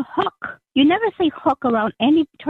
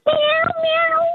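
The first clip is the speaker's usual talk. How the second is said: high, drawn-out cat meows. Acted. Player acting the cat.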